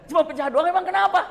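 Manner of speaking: loud, high, laughing shrug; laughing retelling. Retold or imitated. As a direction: repeat his line through laughter. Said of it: "Gitu bilang".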